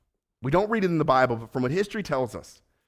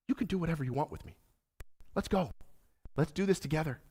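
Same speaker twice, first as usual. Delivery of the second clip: gentle Jesus; 3 semitones higher, 10 dB quieter, faster than usual. Acted. Jesus voiced gently to Peter.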